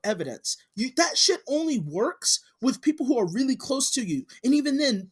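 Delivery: scolding, pitched high and insistent; exasperated rant. Real, not acted. Scolding a fan who believes without evidence, exasperated. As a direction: lay into them, worked up.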